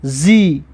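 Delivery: زی said as zee, pitched low; teacher models زی as a low zee; kasra sound zi. Shown as a demonstration. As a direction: model زی with a clear ee. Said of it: "و کسره که دارد".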